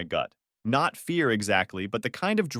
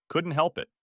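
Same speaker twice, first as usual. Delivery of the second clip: ordinary delivery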